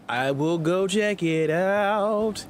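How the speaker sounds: drawn out, every word stretched; slow, exaggerated declaration; mock-dramatic slow. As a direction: stretch it slowly with a long last word. Echoes own phrase in put-on drawl.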